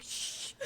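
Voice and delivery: imitated sharp "shh"; mock shushing. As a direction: shush someone as if in public. Retelling him shushing her.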